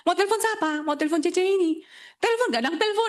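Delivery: high, giggly back-and-forth; half-laughing mock squabble; laughing banter. Couple bickering about a phone call, high laughing banter. Quarrel with girlfriend over call.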